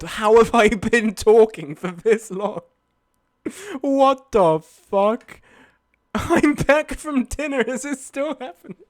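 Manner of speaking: high, loud disbelief; can't believe the time; shocked. Real, not acted. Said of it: "shock at stream length".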